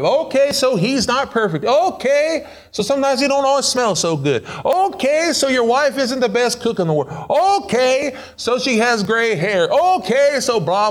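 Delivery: high, bouncy shrugging okays; building okay list. Acted. Preacher shrugging off spouse flaws to the congregation, high and bouncy. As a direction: toss off each flaw with a lilting okay.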